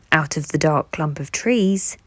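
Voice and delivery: ordinary delivery, nothing marked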